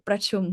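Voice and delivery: ประชุม with the R rolled after the P; ประชุม as pra-chum with a neatly trilled R. Shown as a demonstration. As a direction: roll the ร in ประชุม. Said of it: "I probably roll R".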